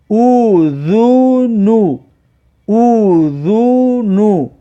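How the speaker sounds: slow, long held "oo" vowels; drawn-out model reading. Shown as a demonstration. Teacher shows learners the damma sound, slow and drawn out. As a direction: stretch every vowel of "اوذونو".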